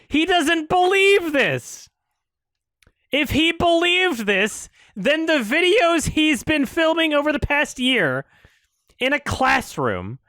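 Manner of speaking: angry rant at the screen; exasperated shout. Real, not acted. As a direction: hammer it home in disbelief. Angry ranting at video.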